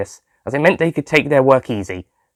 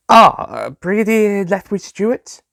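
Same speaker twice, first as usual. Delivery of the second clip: phone caller; 9 semitones higher than usual. A narrator's reading.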